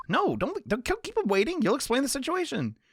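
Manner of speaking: high-pitched, anxious plea; urging companion. Acted. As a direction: urge them on in a high voice. Voicing companion urging him not to delay.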